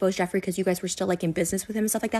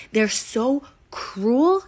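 ordinary talk, nothing marked

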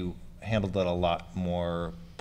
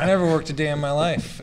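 mock smug boast, pitched higher; 8 semitones higher, 4 dB louder than usual. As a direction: play the smug guy in the ivory tower. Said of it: "I like to be smug from my ivory tower".

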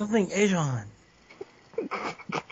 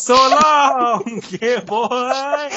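loud, higher mock catchphrase; imitating his friend; 4 semitones higher, 7 dB louder, much slower than usual. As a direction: belt it out like he does, laughing. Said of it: "He says".

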